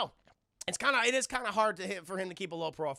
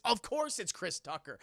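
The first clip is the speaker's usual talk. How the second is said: normal talking voice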